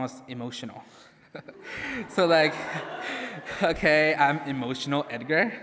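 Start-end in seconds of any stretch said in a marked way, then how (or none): 1.3-2.0 high burst of laughter; embarrassed high giggle. Real, not acted. Laughing at own nickname story. 2.1-5.6 giggly and louder; louder laughing self-introduction. Retold or imitated. Retells own awkward intro.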